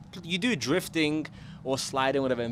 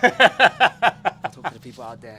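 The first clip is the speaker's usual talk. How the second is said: laughing, announcer-like; 14 dB louder, slower than usual. Real, not acted. Playful address to audience.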